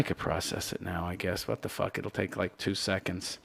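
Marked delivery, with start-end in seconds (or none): none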